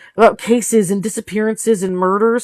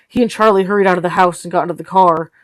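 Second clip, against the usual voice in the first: usual speaking voice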